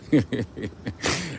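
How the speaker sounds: low, quiet chuckle; quiet trailing laugh; soft chuckle. Real, not acted. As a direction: chuckle softly to yourself. Laughing along with a laughing viewer.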